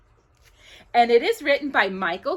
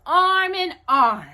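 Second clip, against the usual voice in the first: ordinary delivery, nothing marked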